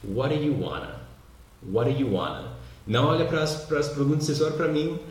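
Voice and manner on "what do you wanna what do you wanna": "What do you wanna" linked as "waddayu-wanna"; casual native-style linked "What do you wanna"; words run together. Shown as a demonstration. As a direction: slur "What do you" into one quick "waddayu" before "wanna". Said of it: "como assim você juntou todas as palavras".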